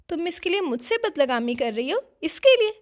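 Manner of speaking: indignant demand, jumping high and loud at the end; angry confrontation. A narrator's reading. Aunt confronting the girl before slapping her, angry. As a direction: challenge her, exploding on the repeated question.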